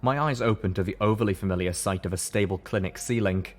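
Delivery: ordinary delivery, nothing marked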